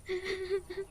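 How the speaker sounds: put-on a short choked sob of a girl. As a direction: let one small sob break out. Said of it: "बहुत रोती है". (सिसकी)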